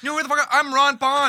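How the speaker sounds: mock shout. Quoting drunk Ron Pond line.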